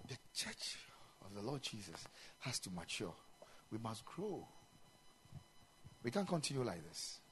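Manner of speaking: a quiet earnest plea to the church; hushed urging. Real, not acted. Preacher drops to hush.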